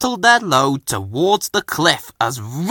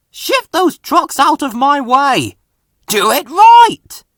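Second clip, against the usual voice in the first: higher, louder, slowing into a stern command; steaming order; 14 semitones higher, 7 dB louder than usual. A narrator's reading. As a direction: drive the order home. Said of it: "steamed Fergus".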